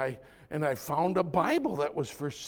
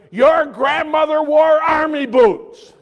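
shouted and slowed, hammering each word; a hammed-up fire-and-brimstone opener; mock harsh shout; 8 semitones higher, 14 dB louder than usual. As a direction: bellow it like a scolding. Preacher mocks a harsh approach.

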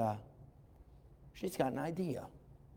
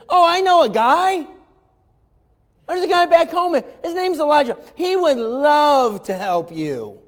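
put-on high, loud gushing young servant girl; eager little maid; 11 semitones higher, 13 dB louder than usual. Little maid telling Naaman's wife about the prophet, high and gushing. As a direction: bubble over like an excited young maid. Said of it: "She says".

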